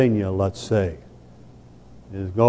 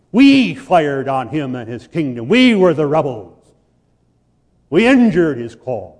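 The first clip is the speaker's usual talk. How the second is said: higher, louder pulpit cry; fiery sermon climax, higher and louder; full-force preaching; 5 semitones higher, 6 dB louder than usual. Acted. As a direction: thunder it from the pulpit, building.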